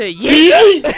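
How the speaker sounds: loud, slow shouted dare; excited yell before a throw; gleeful yell. Real, not acted. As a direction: wind up and yell it. About to throw a character.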